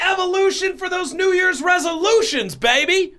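high-pitched hype-man holler; over-the-top high yell; hyped-up shout. Pretend. Hyped-up opening shout of the show.

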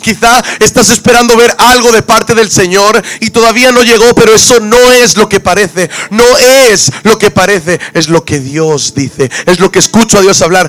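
impassioned, driving pulpit delivery; preaching at full force. Real, not acted. Preacher urges those still waiting on the Lord, preaching at full force. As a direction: hammer the key phrases home with power.

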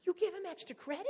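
mock the kids' shocked squeal; outraged kids. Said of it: "And the rest of the kids like".

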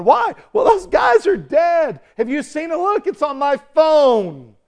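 loud, high-pitched acted excitement; excited news-spreading. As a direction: exclaim like an onlooker sharing huge news. Acting out excited onlookers spreading news.

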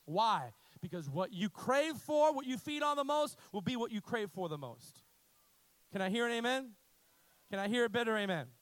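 loud rising preacher's call; fiery pulpit call to the church; preaching. Acted. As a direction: build it up and call for an amen. Preaching at full force.

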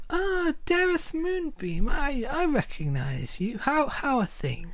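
high, slow and soft, stretched out; lilting elf greeting. Acted. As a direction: greet an old friend fondly, drawing out each word. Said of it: "Dareth, and goes".

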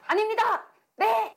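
high, crisp soldier's reply; korean military style. Shown as a demonstration. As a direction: snap out like a recruit. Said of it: "막 이런 말투". (높고 절도 있는 군대 말투)

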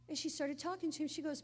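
usual speaking voice

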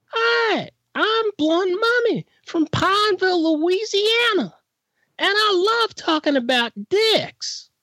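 very high, slow put-on woman's voice; squeaky high voice. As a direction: pitch way up like a cartoon. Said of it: "why do you sound like Mister fucking Bill?".

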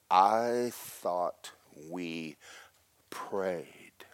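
very slow, word by word, falling to a whisper; menacing rebuke. Retold or imitated. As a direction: imitate a fierce woman confronting you nose to nose. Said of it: "fire flies out of her eyes".

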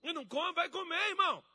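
high, loud mock-furious shouting; exasperated scolding. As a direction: berate someone who begs with food in hand. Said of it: "Ele diz assim".

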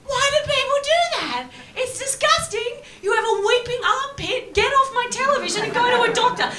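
shrill outrage at the screen; disgusted rant. Real, not acted. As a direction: yell at the TV in disgust. Ranting at the TV.

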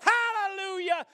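high, fervent preaching shout; exultant pulpit cry; triumphant shout. Shown as a demonstration. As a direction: build to a high, loud hallelujah. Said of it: "disposed of with a shout".

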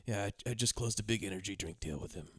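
low, soft, dejected delivery; sad. Retold or imitated. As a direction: say it like your heart isn't in it. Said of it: "He says that very sad".

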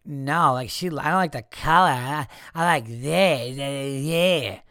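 slow, dopey imitation of a kid; mocked dope. Shop kid giving an opinion on colors, slow dopey mockery. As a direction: drag it out like a clueless dope. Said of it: "You fucking dope".

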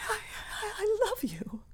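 halting, faint whisper; broken whisper after learning of the betrayal; stunned whisper. Acted. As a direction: whisper it in disbelief. Woman repeats lover's betrayal.

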